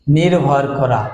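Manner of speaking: usual speaking voice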